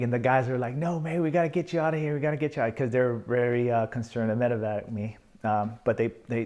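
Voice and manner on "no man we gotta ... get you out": urgent reply. Retold or imitated. Soldiers urging evacuation.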